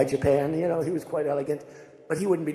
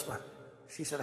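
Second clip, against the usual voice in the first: normal talking voice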